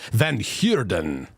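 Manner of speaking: "Van Heerden" drawn out slow, vowels stretched as "Van Hyoorden"; slow, mock-foreign stretch of "Van Heerden"; odd put-on pronunciation. Shown as a demonstration. To a viewer, sounding out his surname slowly in a put-on voice. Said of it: "I don't know why I'm saying it like that".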